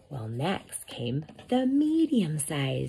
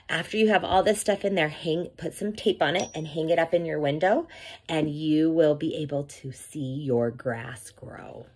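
ordinary delivery

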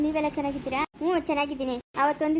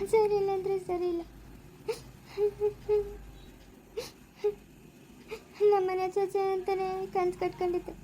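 tearful lament, higher-pitched with sobs between phrases; weeping, voice pitched up, halting between phrases; crying; 4 semitones higher than usual. Acted. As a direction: pour out the heartbreak through sobs. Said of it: "ಅಳ್ಬೇಡಿ ಸುಮ್ನೆ ರೀ ಅಖಿಲಮ್ಮ".

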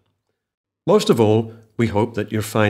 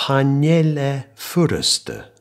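slow demonstration; 5 semitones higher, much slower than usual. Shown as a demonstration. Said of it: "Try saying that".

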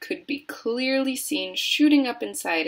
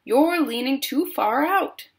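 raised, higher warning; shouting; 5 semitones higher, 4 dB louder than usual. A narrator's reading. Mister Gloop warning his leaning son, mild shout. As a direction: warn him urgently, short of a full yell. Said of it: "shouted Mister Gloop".

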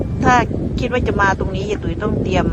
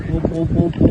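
deep swan call; 4 semitones lower than usual. Retold or imitated. Vlogger mimics the father swan's bigger voice, deep honking. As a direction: imitate a big bird's deep call. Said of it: "พ่อเสียงใหญ่กว่า".

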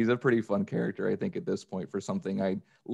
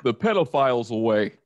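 Kronk cologne ad; 8 semitones higher, 5 dB louder than usual. Pretend. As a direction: sell the skunk musk like a dopey hero. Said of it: "Can you please sell me cologne as that guy?".

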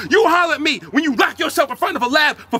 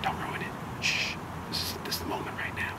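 whispering; 13 dB quieter than usual. Acted. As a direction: keep it to a tense whisper. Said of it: "Why are you whispering".

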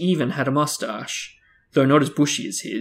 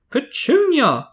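high, loud drawn-out shout of a name; 7 dB louder, slower than usual. A narrator's reading. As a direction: hail her across the house. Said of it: "shouted Aunt Marge".

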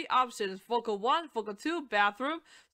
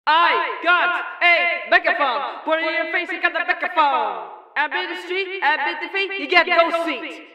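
intercom effect; 3 semitones higher, 8 dB louder than usual. Shown as a demonstration. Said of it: "use graphic E Q again on the second track".

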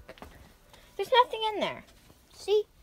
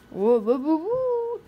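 ghost voice. Shown as a demonstration. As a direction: try out a spooky ghost moan. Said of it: "that's a good ghost voice".

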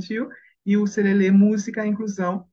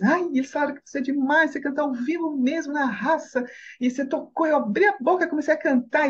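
higher-pitched excited retelling; very happy report; 9 semitones higher than usual. Retold or imitated. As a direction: gush as you pass on the praise. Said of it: "veio me contar toda feliz".

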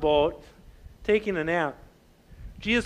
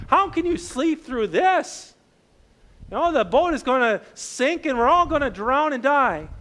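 a touch of upset disciples scolding Jesus; upset reproach; 6 semitones higher than usual. Retold or imitated. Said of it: "getting upset with Jesus".

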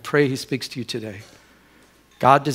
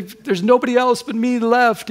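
high-pitched retold lament; mock despairing protest; imitated crying complaint; 9 semitones higher, 3 dB louder than usual. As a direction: complain like a tearful prophet, without real tears. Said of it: "And he's crying".

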